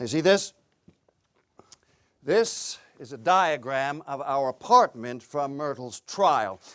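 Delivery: ordinary talk, nothing marked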